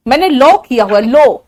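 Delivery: लॉ as "loh", round and punched; emphatic round o on लॉ; Haryanvi pronunciation. Retold or imitated. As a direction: mimic लॉ with hard-rounded lips. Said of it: "और जो हरियाणा वाले".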